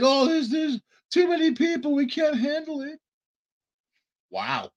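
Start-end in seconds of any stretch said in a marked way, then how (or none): 0.0-3.0 high, whiny mock of officials; mocking whiny quote. Mocking the officials who say they can't cope, in a high whine. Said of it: "She said".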